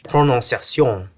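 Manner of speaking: "prononciation" with a French accent, nasal "on" vowels; French word. Shown as a demonstration. Explaining the word's French source, in a French accent. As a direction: give "prononciation" its French sound, pro-non-see-a-see-on. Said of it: "borrowed it from French".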